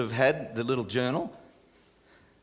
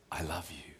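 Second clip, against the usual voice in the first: much softer, lower, tender hush; calming voice of God; 13 semitones lower, 14 dB quieter than usual. Acted. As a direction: say it like God calming a frightened child. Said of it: "he has such a calming voice".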